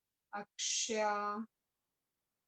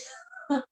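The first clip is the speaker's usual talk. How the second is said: embarrassed laugh; 4 semitones higher than usual. Real, not acted. Laughs at name mix-up.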